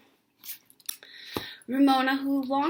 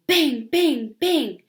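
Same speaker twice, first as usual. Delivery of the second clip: louder, punched out like drumming heels; banging noise; 5 semitones higher, 7 dB louder, much slower than usual. Acted. Ramona kicking the wall, loud banging. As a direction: hammer each word.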